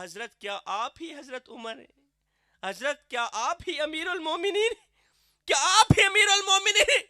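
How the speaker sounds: disbelief rising to tears; stunned question. Acted. Bedouin realizing he is facing Umar, rising into a tearful cry. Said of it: "کہا".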